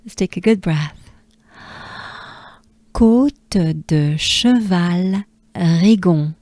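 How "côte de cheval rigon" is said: slow French drill, each syllable clear; slow careful French; French pronunciation. Shown as a demonstration. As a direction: sound out the French slowly. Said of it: "Let's do it together".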